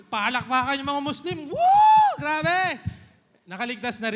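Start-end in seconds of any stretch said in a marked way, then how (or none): 1.5-2.8 very high loud whoop; crowd cheer. Acted. (sigaw ng nagpapalakpakang tao)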